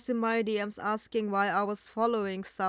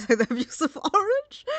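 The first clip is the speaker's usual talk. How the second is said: laughing, louder and higher incredulous question; amused disbelief; 7 semitones higher, 6 dB louder than usual. Real, not acted. Reacting to the abusive orange claim, amused disbelief. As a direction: repeat it through a laugh, incredulous.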